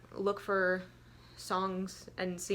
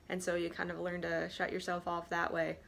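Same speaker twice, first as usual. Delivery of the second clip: ordinary talk, nothing marked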